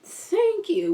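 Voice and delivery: a grandma's mock-gracious retort; evil grandma voice. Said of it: "four or five different voices".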